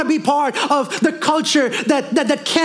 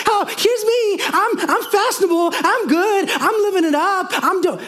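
preening, much higher voice, showing off; mock show-off; 7 semitones higher than usual. Pastor mocking a churchgoer posting their drip, high preening show-off voice. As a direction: lay on the vanity, pitched way up.

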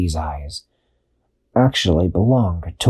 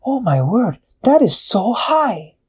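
much higher, slow astonished penguin; amazed penguin; 12 semitones higher than usual. A narrator's reading. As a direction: marvel at it like a little penguin. Peso amazed.

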